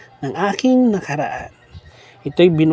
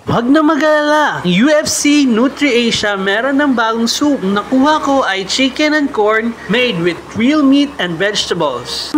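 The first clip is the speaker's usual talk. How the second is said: upbeat, higher, fast sponsor read; 4 semitones higher than usual. Acted. Host reads soup sponsor ad to listeners, bright and upbeat. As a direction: sell the soup like a cheerful radio ad.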